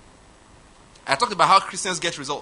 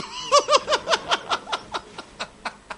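high wheezing laugh, tapering off; helpless high-pitched cackle, fading; big laugh. Real, not acted. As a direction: burst out laughing at your own joke. Laughing at own Isaac joke.

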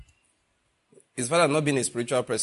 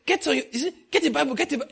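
mock urging; 13 semitones higher, 3 dB louder, much faster than usual. Mimics convert on phone.

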